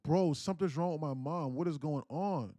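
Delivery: panicked cry, partly held back for storytelling; retold alarm. Acting out father's panicked alarm.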